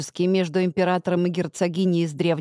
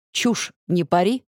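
dragon growl. Acted. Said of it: "прорычал вдруг дракон".

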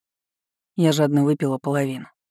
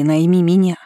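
hoarse, wheezing, pushed louder; wheezy hoarse; 3 semitones higher, 7 dB louder than usual. Acted. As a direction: rasp it out on little breath. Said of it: "просипела я".

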